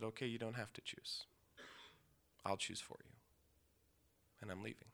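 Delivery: a hushed, barely voiced goodbye; softly whispered resolve to his mother; quiet whisper, no tone in the voice. Final words leaving his mother.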